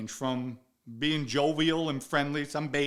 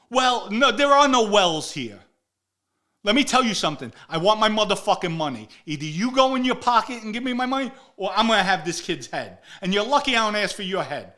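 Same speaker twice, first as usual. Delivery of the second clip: loud, raised, menacing demand; angry threat; 6 semitones higher, 7 dB louder than usual. Retold or imitated. Demanding his money from Blaze, loud and threatening. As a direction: lay down the law, furious.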